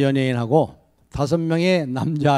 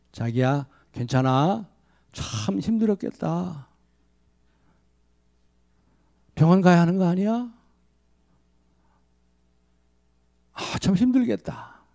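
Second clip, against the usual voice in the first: softer, gentle comforting words; tender sympathy; 3 semitones higher, 4 dB quieter than usual. Shown as a demonstration. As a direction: soothe her like a caring husband. Model tender answer to wife.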